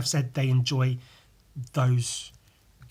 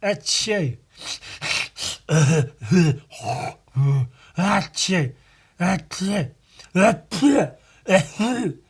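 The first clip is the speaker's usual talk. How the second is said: a real sneezing fit with a laugh in between; real sneezes; 4 semitones higher, 4 dB louder, much slower than usual. Real, not acted. Vlogger caught in a real sneezing fit, real sneezes. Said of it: "here it comes".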